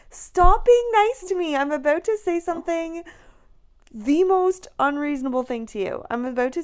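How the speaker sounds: high, loud, hammed-up frantic wail at him; exaggerated whiny outburst. Pregnant wife wailing at kind husband, hammed-up high loud outburst. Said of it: "You're like Rachel from Friends".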